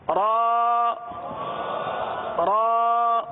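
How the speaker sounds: long drawn-out letter ra; demo letter sound. Shown as a demonstration. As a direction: hold the ra steady like a tajweed teacher. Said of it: "حروف کی ادائیگی کو بہتر کرنے کے لیے". (تجوید میں حرف را کی لمبی ادائیگی)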